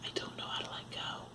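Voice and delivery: barely audible, voice falling away; choked-up near-whisper. Real, not acted. Woman confessing she can't let go of her past, choked-up whisper. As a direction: whisper it, struggling to get it out.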